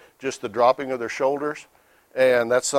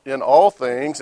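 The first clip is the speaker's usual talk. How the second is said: "all" punched, louder and held; stress on all; 4 dB louder, slower than usual. Shown as a demonstration. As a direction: lean hard on "all" in "in all things". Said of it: "and I emphasize the word all".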